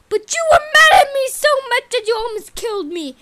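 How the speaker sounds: fast angry cry. Acted. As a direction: hurl the accusation fast. Acted quarrel, accusation.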